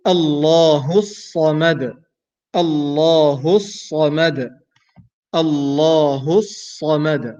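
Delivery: slow, drawn-out Allahu Samad; slow recitation. Shown as a demonstration. Teacher reciting the next verse for students. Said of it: "move to the next ayah".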